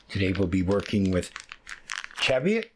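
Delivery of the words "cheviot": Cheviot said CHEV-ee-ut, pitched high with a questioning lift; "Cheviot" as CHEV-ee-ut, high and unsure; trying out pronunciations. Shown as a demonstration. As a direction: offer "Cheviot" as a tentative guess, voice rising high. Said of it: "however it's pronounced".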